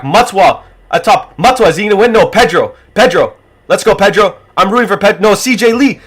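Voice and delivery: root loudly for the winner; excited cheering. Real, not acted. Cheering ducks in a race.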